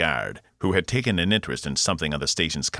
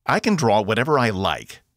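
yelling; 5 semitones higher, 5 dB louder than usual. A narrator's reading. Box keeps yelling at guard.

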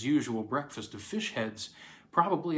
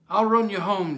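higher and louder call; 9 semitones higher, 9 dB louder than usual. Acted. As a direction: call over to him. Deputy calls to Jeff.

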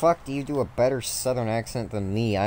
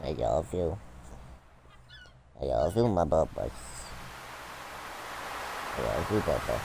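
tender cooing to dog; 5 semitones lower, 8 dB quieter than usual. Real, not acted.

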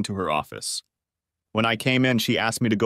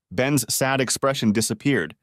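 ordinary delivery

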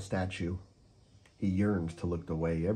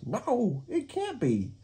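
very high shocked parakeet; can't believe your eyes; gawking disbelief; slower than usual. Acted. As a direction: parakeet horrified at the paint. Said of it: "Parakeet gawks".

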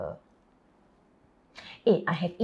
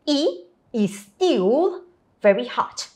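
slow careful repeat; slower than usual. Shown as a demonstration. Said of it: "repeats after teacher".

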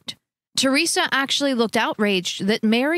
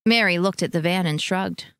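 ordinary delivery, nothing marked

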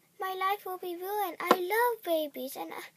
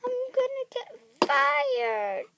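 high, slow, miserable wail; distressed wail; 5 semitones higher, 7 dB louder than usual. Acted. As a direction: moan it out in despair. Panicking over lost babies.

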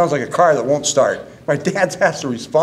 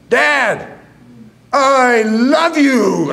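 slow, high, drawn-out yell; 8 semitones higher, 3 dB louder, much slower than usual. Retold or imitated. Son finally yelling I love you to his deaf father, slow and high. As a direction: belt it down the phone line. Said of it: "I'm yelling the phone".

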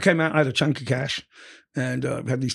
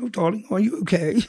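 higher, lightly voiced fussing mother; imitating mum; 7 semitones higher than usual. Mum hearing her son was in jail, lightly mock doting. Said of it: "She goes".

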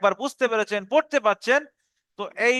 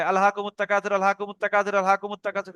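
rushed recitation; faster than usual. Shown as a demonstration. Teacher mocks students rushing the verse, hurried sloppy recitation. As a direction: rattle it off carelessly, the wrong way. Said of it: "একদম হুটপাট করে নয়".

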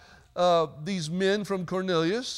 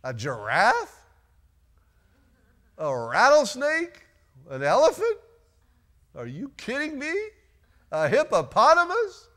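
rising, disbelieving questions, mostly higher; incredulous; 6 semitones higher than usual. Acted. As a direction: ask it in mock horror. Mock disbelief at eating animals.

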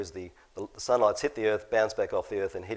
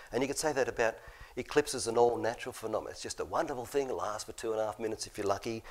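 ordinary talk

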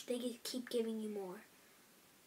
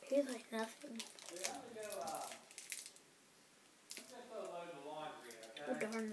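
chewing candy; talking while eating; mouth full; 3 semitones lower, 6 dB quieter than usual. Real, not acted. Seen 0: Hand to mouth before; lips pursed, cheek working during words.

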